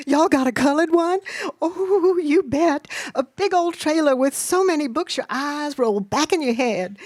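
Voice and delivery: put-on high, thrilled child's chatter; girlish excitement. A narrator's reading. Two girls compare bookmobiles, excited. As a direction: bubble over, pitched well up.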